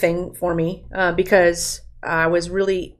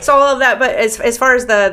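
laughing; 6 semitones higher, 7 dB louder than usual. Real, not acted. Laughing after the joke.

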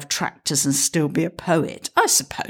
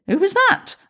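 Millie's voice; 3 dB louder than usual. Acted.

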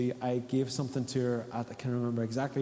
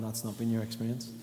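ordinary delivery

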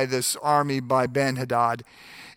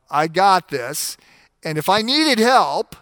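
mock swagger, louder on the end; cocky attitude; 4 semitones higher, 6 dB louder than usual. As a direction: toss it off like a confident boss. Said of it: "he doesn't say it with quite this attitude".

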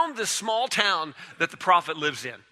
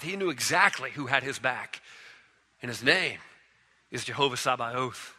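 ordinary talk

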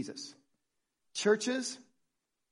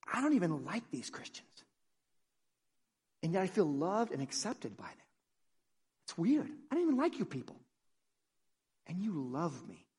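soft, halting disbelief with long pauses; hushed wonder; 7 dB quieter than usual. Acted. Visitor surprised to feel accepted by Christians, in hushed wonder. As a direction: puzzle it out softly, as if confiding. Acts out visitor moved by grace.

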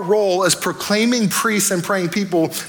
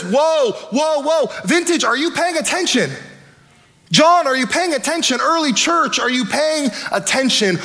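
raised, urgent eagle cry, higher pitched; eagle crying loud; 6 semitones higher than usual. Acted. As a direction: call out the warning like a crying eagle. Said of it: "an eagle crying with a loud voice".

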